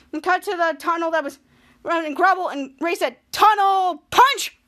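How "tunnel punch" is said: slow, loud, high attack call; mock battle cry. Ray breaking through the tunnel, drawn-out battle cry. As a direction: stretch each word like a signature move.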